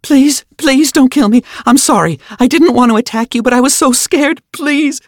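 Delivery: high, loud, desperate begging; shrill pleading. A narrator's reading. Injured teammate begs Finn not to kill her, shrill pleading. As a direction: plead for your life at a shrill, frantic pitch. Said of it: "her voice became more shrill and pleading".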